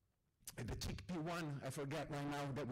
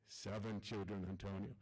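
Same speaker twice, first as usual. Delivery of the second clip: put-on Ahmed's accented English; 10 semitones lower than usual. Storyteller voicing his friend Ahmed at dinner, low accented English.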